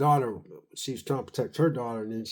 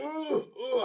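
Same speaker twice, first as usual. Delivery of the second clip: choking grunts; 11 semitones higher, 5 dB quieter than usual. Retold or imitated. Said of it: "he was sitting there going".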